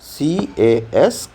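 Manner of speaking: letters spelled out slowly; teacher spelling for children. Shown as a demonstration. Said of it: "cashew नट का क्या हो जाएगा".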